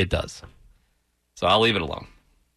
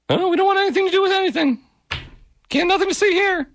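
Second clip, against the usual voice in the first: much higher, fast squeaky mock voice; voicing Switzerland. Host voices Switzerland refusing any side, high. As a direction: play a timid neutral Swiss.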